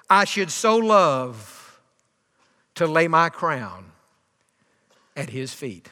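slow, hushed retold devotion, fading away; deep emotion. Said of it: "replied with quivering lips".

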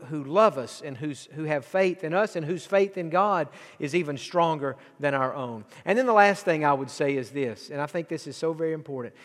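normal talking voice

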